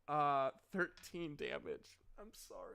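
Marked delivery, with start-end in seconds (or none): 2.2-2.7 seal-like sad whimper. Real, not acted. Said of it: "Why do I sound like a seal when I'm sad?".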